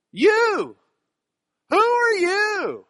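high, loud, drawn-out holler; calling out. Acted. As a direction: fling the question out at full voice, stretching it. Preacher suddenly calls out a challenge.

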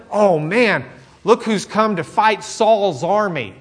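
high, mock awed groan; mock dismay. What a frightened soldier might say, mock dismay. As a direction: pretend to be impressed and scared. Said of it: "it wasn't".

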